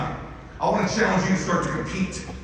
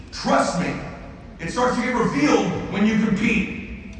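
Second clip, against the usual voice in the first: raised, louder, higher preaching voice; fiery preaching; 8 semitones higher, 3 dB louder than usual. Acted. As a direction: preach it hard at the room, urging them to compete. Preaching to the crowd.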